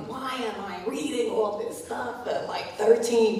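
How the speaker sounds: mock exasperated question; retold complaint. Voices her young self complaining.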